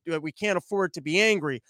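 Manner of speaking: ordinary talk, nothing marked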